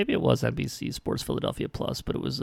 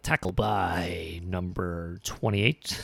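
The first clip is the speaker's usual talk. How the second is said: slow, drawn-out PA announcer voice; 4 semitones lower, much slower than usual. Retold or imitated. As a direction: stretch it like a stadium announcer. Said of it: "He used his announcer voice".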